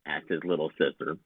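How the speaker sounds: ordinary delivery, nothing marked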